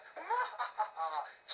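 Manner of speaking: high shrill-pitched witch cackle. Acted. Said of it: "crackled like mad".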